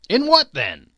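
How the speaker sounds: demanding. Acted. Lovers' quarrel.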